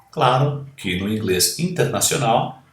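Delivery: usual speaking voice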